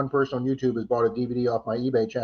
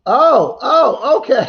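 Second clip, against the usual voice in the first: surprised outburst; 11 semitones higher, 9 dB louder, much slower than usual. Real, not acted. Sudden surprise at chat message.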